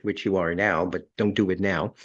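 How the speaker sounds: usual speaking voice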